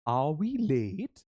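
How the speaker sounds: drawn-out, slow acted question. Shown as a demonstration. Teacher acting out a question for students, slow and drawn out. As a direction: stretch it slowly like a worried question. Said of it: "ऐसी थोड़ी acting करनी है".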